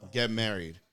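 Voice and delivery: ordinary talk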